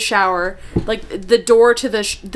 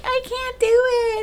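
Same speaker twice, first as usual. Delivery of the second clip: put-on whiny child voice; 14 semitones higher, 5 dB quieter than usual. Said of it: "was like".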